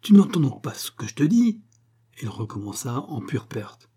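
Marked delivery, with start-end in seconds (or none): 0.0-1.6 high, loud exasperated question; shouting. A narrator's reading. Husband to wife who ignores him, shouting. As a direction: shout it, rising at the end. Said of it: "Monsieur Lahuche cria".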